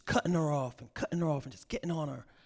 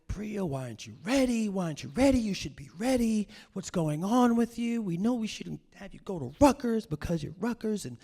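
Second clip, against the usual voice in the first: high, loud, scolding mom; nagging mother; 8 semitones higher, 4 dB louder than usual. Retold or imitated. Mother to daughter who isn't ready, scolding. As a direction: imitate a mother berating her daughter in raised pitch. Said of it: "she is just like".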